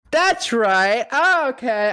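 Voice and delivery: high, loud eager burst; excited recognition. Retold or imitated. The speaker recognizing Two-Face, high and loud. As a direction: it clicks and you're thrilled.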